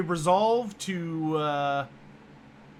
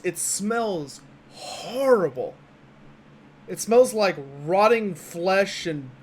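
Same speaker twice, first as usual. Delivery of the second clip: game master narration. Acted. GM sets the scene.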